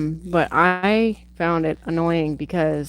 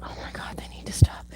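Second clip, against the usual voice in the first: whispered, exasperated inner thought; annoyed whisper; faster than usual. Retold or imitated. Watching annoying teens in a movie, irritated whisper. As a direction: breathe it out, fed up with the kids.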